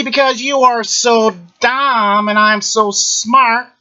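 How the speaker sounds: high-pitched, drawn-out smug bragging; high mocking boast. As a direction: crow it up high and slow. Mock boasting about not bluffing.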